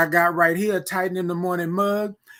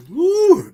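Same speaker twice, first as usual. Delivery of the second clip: pleased whoop; 13 semitones higher, 6 dB louder than usual. Real, not acted. Pleased whoop after a sip.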